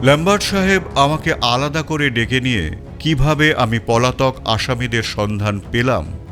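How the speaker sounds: plain reading voice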